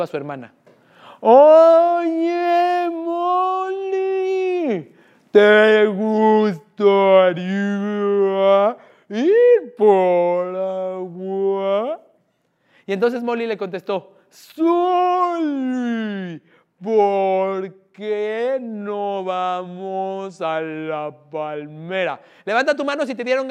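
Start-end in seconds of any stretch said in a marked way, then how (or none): 1.2-12.0 camel voice. Acted. Camel Soli speaks in joke. 14.3-22.2 camel voice. Acted. Camel Molly answers in joke.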